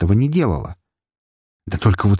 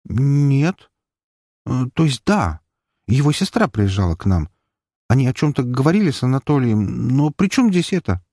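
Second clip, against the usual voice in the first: flustered; 6 semitones higher than usual. A narrator's reading. Flustered, confused answer.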